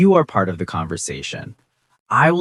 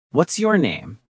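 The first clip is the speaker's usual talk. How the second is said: "What's your name?" starting higher, falling on "name"; falling intonation; 4 semitones higher than usual. Shown as a demonstration. Teacher models an information question, "name" falling at the end. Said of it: "This falling intonation is very common".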